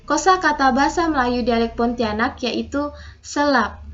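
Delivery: usual speaking voice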